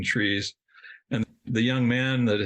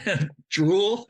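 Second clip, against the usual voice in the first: laughing; 8 semitones higher than usual. Real, not acted. Laughing while admitting he drooled over the men. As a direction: laugh through the words, pitch jumping up.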